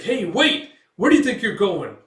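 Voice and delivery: high, loud indignant shout; acted "come back" yell; urgent calling out. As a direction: call after someone walking off. Calling after someone leaving.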